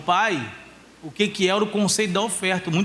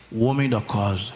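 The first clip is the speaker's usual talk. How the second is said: put-on much lower, puffed-up boy's voice; deep boy voice; 12 semitones lower than usual. Son proudly answering his father, in a deep voice. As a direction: drop the voice low like a proud little man. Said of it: "Ele fala até grosso".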